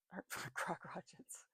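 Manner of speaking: slow, breathless giggle through crock rockets; giggling whisper. Real, not acted. Reader giggling at her own wrong word. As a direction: whisper it while cracking up.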